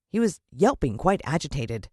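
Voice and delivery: ordinary delivery, nothing marked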